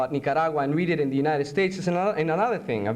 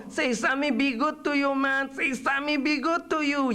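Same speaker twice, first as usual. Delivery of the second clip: high, wheedling barrio voice; pleading sidekick; 10 semitones higher than usual. Acted. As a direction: coax the prisoner in a high voice. Coaxing figure in poem.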